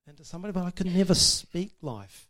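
ordinary talk

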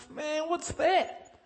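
high, slow, baffled drawl; baffled mate. Retold or imitated. Mate staring at a strange church guy, slow and baffled. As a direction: stretch it out in amazement. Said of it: "I have my mates".

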